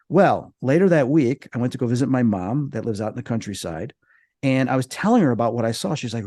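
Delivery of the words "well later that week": ordinary talk, nothing marked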